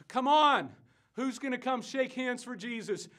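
loud, high rallying shout; preacher's booming summons; urgent altar call. Acted. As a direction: holler them forward to the altar.

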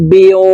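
"বিউ" closing on rounded lips; rounded waw. Shown as a demonstration. Teacher modelling waw for viewers, lips rounded. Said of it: "ঠোঁট গোল করে উচ্চারণ করতে হবে".

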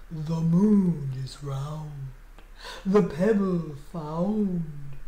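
low, slow, chanting lizard voice; mystical lizard. A narrator's reading. Magic lizard answers Alexander's call, mystical and slow. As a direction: intone it deep and drawn out.

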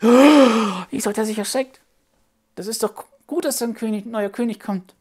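can't believe anyone would be scared; astonished puppet child. Acted. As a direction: puppet child baffled at the king's fright.

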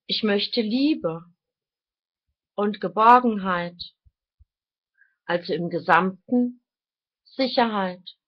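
deliberate, declaimed phrases, each set apart; reading aloud. Real, not acted. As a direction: read your written thoughts out like solemn maxims. Reads own written thoughts aloud.

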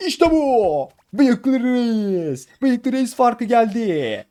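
slow, high, drawn-out hype call; mock announcer boast. Gamer hyping himself to viewers after a win, slow mock announcer boast. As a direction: announce your own win like a ring announcer.

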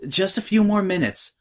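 high, distracted young pony voice; cartoon princess. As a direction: answer without looking up. Twilight voiced in story.

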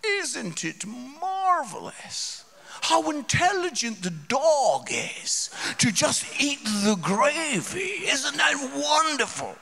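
high, slow gushing admiration; gushing London lady. Retold or imitated. London audience member gushing about the clever dog. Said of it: "she was like".

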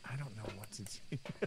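helpless whisper. Real, not acted. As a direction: breathe it out, lost for ideas. Helpless after teammate died.

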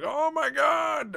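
very high, drawn-out shout; mock astonished shout. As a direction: cry out in hammed-up shock. Imagined shock at seeing stealth drone.

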